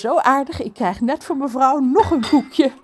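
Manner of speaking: high, loud rapid chatter; chattering mermaid. Acted. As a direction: rattle on breathlessly. Trudy praat. (ratelende zeemeermin)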